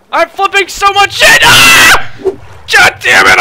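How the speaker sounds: very high, loud frantic screams; panicked screaming. Real, not acted. Trying to climb into the boat, frantic high screams. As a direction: scream for your life in the water.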